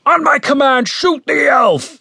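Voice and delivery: high, carrying order, slowing at the end; commander's shout. A narrator's reading. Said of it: "said the voice outside".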